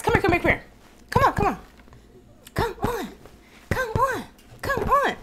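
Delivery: high sing-song dog call. Real, not acted. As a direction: coax the dog over sweetly. Calling the dog to her.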